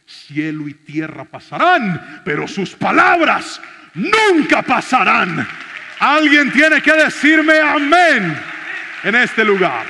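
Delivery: slow, loud, high, proclaiming each word; full-force preaching. Acted. Preacher rouses the congregation to shout amen, booming and slow.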